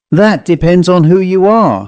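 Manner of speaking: a sharp little man who knows he's right; dapper man. A narrator's reading.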